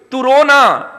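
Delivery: a father snapping at his crying son; stern father scolding. Retold or imitated.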